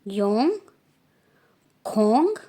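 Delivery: yong and khong called out loud, khong with a breathy kh; teacher repeating the numbers; careful Korean counting. Shown as a demonstration. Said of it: "देखो दोबारा से".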